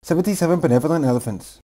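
rattled off at top speed; tongue twister as fast as possible. Shown as a demonstration. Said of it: "Say the tongue twister faster and faster".

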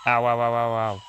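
repeated ow, yelped in pain; pained ow yelps. Real, not acted. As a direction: yelp ow as you get hit. Taking damage in game.

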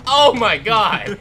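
loud, slow, laughing oh my god; real laughing shock; laughing scream. Real, not acted. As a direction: laugh it out after a fright. Startled by a jump scare.

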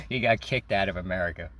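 ordinary talk, nothing marked

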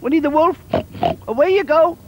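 high, loud squeaky piggy chorus; squeaky piglets together. Acted. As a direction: shoo the wolf off in piggy voices. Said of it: "they said in unison".

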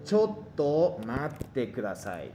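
slow, careful Japanese, each syllable clear; slow model phrase. Shown as a demonstration. Teacher modelling the phrase for students, slow and careful. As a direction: spell it out slowly for the class. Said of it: "aquí dice".

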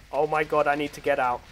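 ordinary talk, nothing marked

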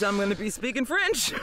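ordinary talk, nothing marked

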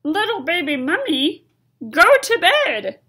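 high, loud skeleton voice; loud high-voiced skeleton; Bones's warning. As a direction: warn the little one loudly. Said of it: "he said".

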